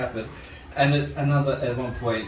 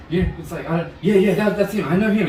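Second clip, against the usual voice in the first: mock-hysterical, higher and louder; raised, insistent imitation of a frantic accuser; hysterical woman; 5 semitones higher, 5 dB louder than usual. Hysterical woman brought over picks out her suspect, imitated in agitation. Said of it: "like, hysterical and shit".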